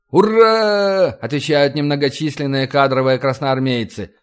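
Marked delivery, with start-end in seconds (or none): 0.1-1.1 loud high hurrah; cheering. A narrator's reading. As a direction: shout the hurrah. Soldiers cheer.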